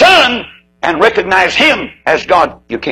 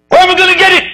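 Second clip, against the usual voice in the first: alarmed cry; 4 dB louder, faster than usual. Acted. Sinner fears a heart transplant.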